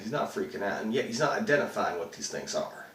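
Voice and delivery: ordinary talk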